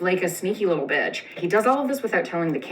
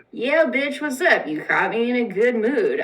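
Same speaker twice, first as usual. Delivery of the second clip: breezy king; 6 semitones higher, 3 dB louder than usual. Acted. King answers Esther casually.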